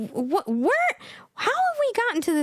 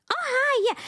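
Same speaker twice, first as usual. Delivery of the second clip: very high, sing-song small talk; mocked non-English speaker; 11 semitones higher than usual. Imitating women with little English making small talk, high mock chirp. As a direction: chirp empty pleasantries in a high voice. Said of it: "This is how they talk".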